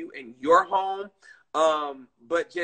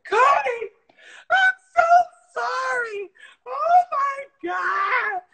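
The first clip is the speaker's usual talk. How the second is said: much higher, loud crying wail of an apology, slowed on oh my God; sobbing apology; 8 dB louder, slower than usual. Retold or imitated. Owner reenacts sobbing apology to her declawed cat, a loud high wail. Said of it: "bawled my eyes out".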